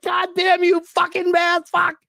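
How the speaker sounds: high mock yell at the players; mock TV rant. Fan cursing the team from the couch, high mock yell. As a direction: curse at the TV like a furious fan. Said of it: "yelling at a T V like".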